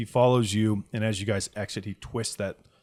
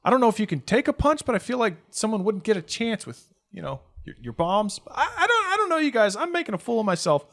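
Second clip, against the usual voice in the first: put-on high, hurried stammering boy; flustered kid; 12 semitones higher, 4 dB louder than usual. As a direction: backpedal in embarrassment.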